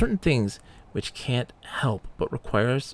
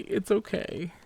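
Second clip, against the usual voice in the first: higher, coaxing it's okay to a buddy; higher, tender reassurance to a weak companion; reassuring; 9 semitones higher, much slower than usual. Pretend. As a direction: soothe the little guy, lifted pitch. Comforting weak game character.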